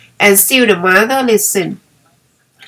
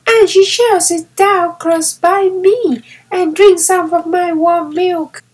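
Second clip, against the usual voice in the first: put-on much higher child's voice, eager and sweet; small boy dreaming of hugging the snow girl; child talking happily; little boy Peony; 10 semitones higher than usual. A narrator's reading. Peony speaks in story.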